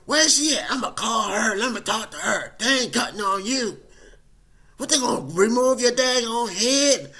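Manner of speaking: loud, high, outraged mother; mama bear demanding the doctor; protective indignant mom. Retold or imitated. Mom defending her son against the doctor, loud indignant.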